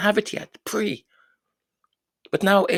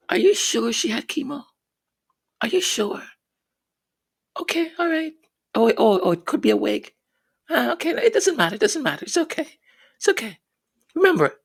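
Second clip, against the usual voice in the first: sarcastic, higher mock reassurance; 6 semitones higher, faster than usual. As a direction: pretend to go along, sarcastically.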